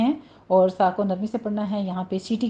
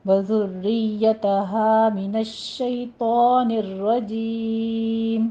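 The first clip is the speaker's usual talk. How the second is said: slow, measured tajweed recitation; Quran recitation; slower than usual. Shown as a demonstration. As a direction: recite each word slowly with full elongation.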